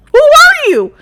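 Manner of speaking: high-pitched laughing disbelief; a real laughing shriek of surprise; shocked laugh. Real, not acted. Reacting to shocking reality show.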